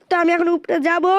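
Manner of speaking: normal talking voice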